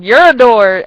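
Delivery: loud catchphrase your door; running joke yelled; mock shout. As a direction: fling the joke line out loud. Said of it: "It was like".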